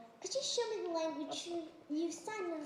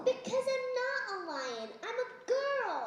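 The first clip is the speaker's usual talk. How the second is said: higher-pitched, insistent objection; indignant protest; 6 semitones higher, 3 dB louder than usual. Acted. Girl refusing to play animals, indignant protest. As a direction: push back firmly, voice raised in pitch. Child rejects the animal game.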